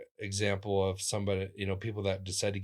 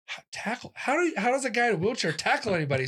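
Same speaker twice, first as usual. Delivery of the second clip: much higher and louder, fast incredulous question; incredulous disbelief; 13 semitones higher, 4 dB louder, faster than usual. Retold or imitated. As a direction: sound baffled that a wheelchair user could tackle. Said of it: "I'm like".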